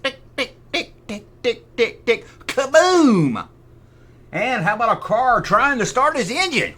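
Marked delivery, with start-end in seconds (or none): 0.0-2.3 bomb ticking. Pretend. Imitating time bomb ticking. 2.5-3.5 loud high kaboom; explosion. Pretend. Finishing the time bomb imitation, loud kaboom.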